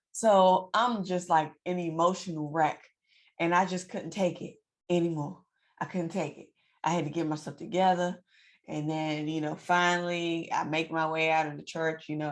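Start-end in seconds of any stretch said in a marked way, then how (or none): none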